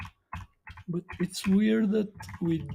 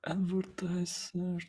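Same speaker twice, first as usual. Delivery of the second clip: whispering; 3 semitones higher, 6 dB quieter than usual. Real, not acted. Reading aloud under breath.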